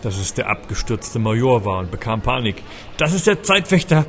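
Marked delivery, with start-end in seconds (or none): 3.0-4.0 high, urgent desperate call; desperate cry. A narrator's reading. Narrator cries out desperately to the crowd. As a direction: cry it out in despair. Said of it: "rief ich verzweifelt".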